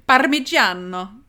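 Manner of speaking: hammed-up Italian accent on parmigiano; Italian pronunciation. Shown as a demonstration. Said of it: "Or as the Italians would say".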